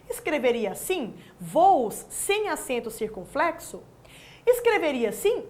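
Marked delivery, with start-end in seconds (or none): none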